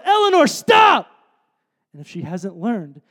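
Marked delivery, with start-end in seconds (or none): 0.0-1.1 high, loud, drawn-out shout to stop her; urgent yell. Retold or imitated. Father shouting at his daughter running into the street, an urgent yell. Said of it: "I'm gonna yell".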